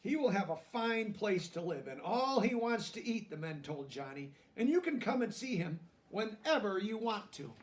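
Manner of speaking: lively picture-book narration; reading to children. A narrator's reading. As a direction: read it like a bedtime story to kids.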